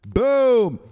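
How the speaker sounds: loud, drawn-out falling boom; big celebratory shout; booming exclamation. Pretend. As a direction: punch it out like an explosion. Celebratory catchphrase called out.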